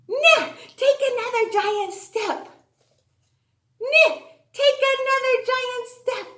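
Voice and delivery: high sing-song calling out steps; game caller. Pretend. Teacher leading kids in a stepping game, high calling voice. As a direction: call each step like a playground game.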